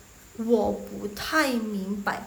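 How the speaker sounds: slow Mandarin with separated syllables; slow model reading. Shown as a demonstration. Teacher introduces phrase number three, slow model reading. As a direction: sound it out carefully for learners. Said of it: "Next yang nomor tiga".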